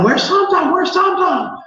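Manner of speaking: put-on high excited child voice; kids calling out. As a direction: call out like an eager little kid. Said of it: "They're going".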